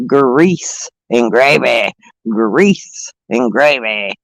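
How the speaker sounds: low, slow drawn-out chant; slow low mock-announcer drawl; drawn-out catchphrase. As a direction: drag the words out deep and slow like a joke greeting. Greeting friend with catchphrase.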